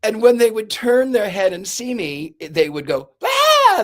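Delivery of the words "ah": sudden high-pitched scream of fright; a startled guest's loud shriek; imitated scream. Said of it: "They would scream".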